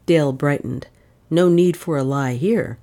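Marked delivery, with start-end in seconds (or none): none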